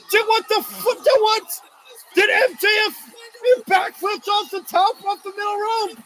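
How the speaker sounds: high, stunned shout of disbelief; dude, what just happened. Real, not acted. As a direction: yell it like you can't believe your eyes. Stunned by a backflip.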